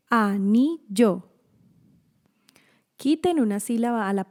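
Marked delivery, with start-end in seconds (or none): 0.1-1.3 "anillo" slowly split as a, ni, llo; syllable by syllable. Shown as a demonstration. Teacher comparing word lengths, "anillo" split a, ni, llo. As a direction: give "anillo" three separate beats: a... ni... llo. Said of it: "Separen y cuenten sílabas".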